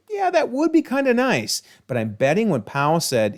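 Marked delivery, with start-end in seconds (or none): 0.1-1.6 much higher, dreamy imagined Frank; a tough guy briefly tempted, voiced up high; wistful Frank. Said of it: "he was like".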